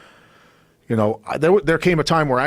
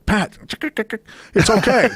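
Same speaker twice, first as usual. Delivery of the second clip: higher, mock-soothing voice of a friend; imitating Tom; 10 semitones higher than usual. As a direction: play the calm friend, pitched up. Tom voiced calmly reassuring.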